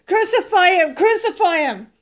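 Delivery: loud, high-pitched demand; mob's cry. Acted. Chief priests and officers seeing Jesus, crying out. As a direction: shout it like a baying mob. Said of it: "they cried out".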